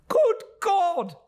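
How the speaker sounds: a startled shout of delight; cried out. Acted. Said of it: "cried Traddles".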